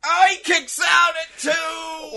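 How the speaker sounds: high, slow shouted call; wrestling commentator. Acted. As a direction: call the kickout like ringside. Calls kickout like commentator.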